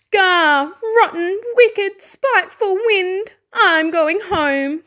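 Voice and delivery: high, drawn-out angry outburst; cried in frustration. A narrator's reading. Fox giving up on the wind, frustrated cry. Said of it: "cried Argyle".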